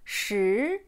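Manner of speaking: "Shi" rising steadily; second tone. Shown as a demonstration. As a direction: glide "Shi" upward like a question. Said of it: "Deuxième ton qui monte".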